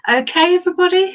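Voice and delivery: high, fast, bright call to the room; rally the whole group, loud and quick; calling out to the class. Real, not acted.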